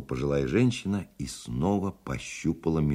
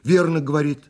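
put-on high, loud young man's cry; young voice shouting; 12 semitones higher, 8 dB louder than usual. A narrator's reading. Young man backs up the old man, crying out high and loud. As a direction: call out excitedly from the dark. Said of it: "закричал из темноты молодой голос".

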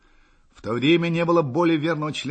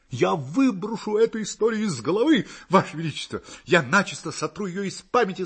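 high, eager, emphatic oath; fervent vow; 7 semitones higher than usual. A narrator's reading. Hussar promising his emperor to forget the affair, in a fervent high voice. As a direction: swear it with breathless soldierly zeal.

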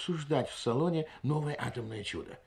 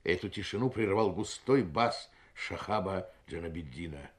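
ordinary delivery, nothing marked

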